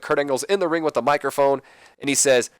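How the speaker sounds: usual speaking voice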